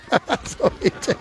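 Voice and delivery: high burst of laughter; laughing. Real, not acted. Commentator after a rider's near crash, laughing.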